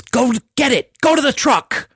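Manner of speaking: high-pitched, urgent order; urgent shout. Acted. Soldier to the man who left his mask, urgent shout. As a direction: shout a panicked command. Soldier urges panicked comrade to truck.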